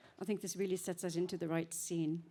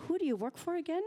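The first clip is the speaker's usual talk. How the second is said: eager question; 10 semitones higher, faster than usual. Retold or imitated. Retold question to him.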